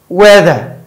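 usual speaking voice

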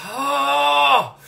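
high, drawn-out "oh" from the burn; pained cry. Real, not acted. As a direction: let out a long high wail. Hot pepper burn kicks in.

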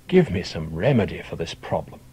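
ordinary delivery, nothing marked

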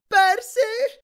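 very high, loud scream of his name; shriek. A narrator's reading. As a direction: shriek at him in alarm. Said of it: "strillò Annabeth".

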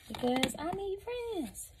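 high, slow, whiny plea for friends; high pleading whine. Pretend. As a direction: stretch it out high and needy. Begging viewers to subscribe.

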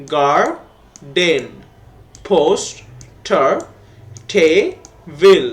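"Garden, poster, table" very slowly, each in two separate beats; split into syllables. Shown as a demonstration. Teacher dividing "garden, poster, table" for pupils, split into syllables. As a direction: pause inside each word: gar-den, pos-ter, te-bil. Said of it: "আমরা ভাগ করি".

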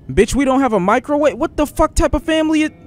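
high, incredulous outburst; outraged disbelief. Real, not acted. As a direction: squeal it in disbelief. No microwave outrage.

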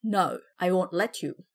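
low, firm refusal; exclaiming. A narrator's reading. Philip standing up to the taller man, exclaiming. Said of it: "Philip exclaimed".

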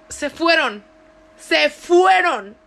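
loud, stretched stress on "fueron"; emphatic disbelief. Retold or imitated. Stunned that her rescuers left.

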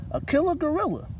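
high, loud panicked squeak; frightened squeak. Acted. Mouse telling Panda about the killer gorilla, squeaking in fear. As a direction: squeal it in terror. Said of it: "she squeaked".

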